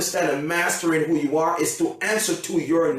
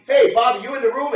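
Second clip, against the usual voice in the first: higher, louder call across a room; 7 semitones higher, 5 dB louder, faster than usual. Acted. Present giver looking for Bobby, calling out. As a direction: call out for someone in the crowd.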